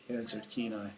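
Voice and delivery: ordinary delivery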